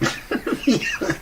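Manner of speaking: short breathy high laugh; a quick high laugh. Real, not acted. Laughing after quote.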